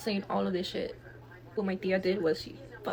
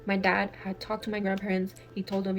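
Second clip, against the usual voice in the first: ordinary talk, nothing marked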